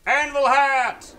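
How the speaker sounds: high, slow battle cry; shouting. Real, not acted. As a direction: yell the move name. Shouted power move name.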